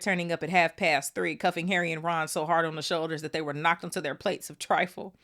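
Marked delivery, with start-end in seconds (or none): none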